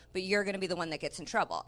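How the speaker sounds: ordinary talk, nothing marked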